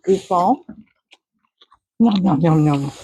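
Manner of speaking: ordinary talk, nothing marked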